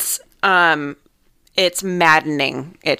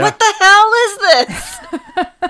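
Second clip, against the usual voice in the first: high, slow exasperated shout; 6 dB louder, much slower than usual. Retold or imitated. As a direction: yell it in laughing disbelief. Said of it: "And I'm like".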